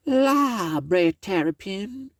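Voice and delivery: a high exclaiming lady. Acted. Miss Meadows answering Brer Tarrypin, high and exclaiming.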